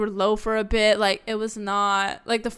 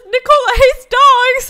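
slow, laughing shout, much higher and louder; mock slogan shout; 12 dB louder than usual. Teasing a friend about a campaign poster, laughing mock shout. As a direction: yell it like a smear-campaign headline.

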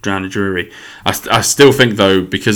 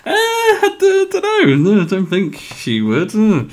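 very high, drawn-out, comic dithering; mock hesitant reply; 14 semitones higher, slower than usual. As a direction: squeak out an awkward, slow refusal. Exaggerated replay of his hesitant reply.

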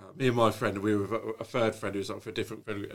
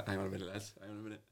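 much softer, leaning-in hush; hushed conspiratorial aside; 2 semitones lower, 14 dB quieter, much faster than usual. Retold or imitated. Mate hushes group conspiratorially.

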